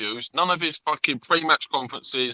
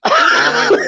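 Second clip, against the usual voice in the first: breathy falsetto "Oh." in a put-on accent; mocking friend's accent; 9 dB louder than usual. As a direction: mimic the friend's dumb realisation with a squeak. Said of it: "for the accent".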